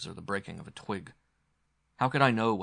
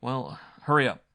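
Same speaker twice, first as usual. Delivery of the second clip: raised, louder prod; impatient urging; 4 semitones higher, 8 dB louder than usual. A narrator's reading. Wounded visitor waiting at the door, impatient. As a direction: hurry him along.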